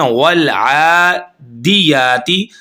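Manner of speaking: आदियाती very slow, vowels drawn long; chanted Quranic recitation; correct recitation. Shown as a demonstration. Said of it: "खड़ी ज़बर आ जाए".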